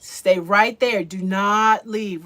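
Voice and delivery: loud. Real, not acted. Begging viewers not to leave.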